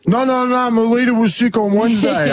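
playful imitation of a sick mate's excuse; mock excuse voice. Mimicking friend's excuse.

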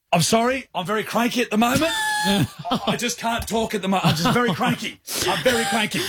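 high-pitched, wound-up apology; very cranky. Retold or imitated. Bitten man fends off a kind lady, very cranky. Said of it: "'cause I'm so cranky".

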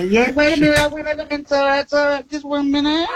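high, teasing "wait a minute"; playful winner's boast. Retold or imitated. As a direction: gloat over the win playfully. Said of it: "you go".

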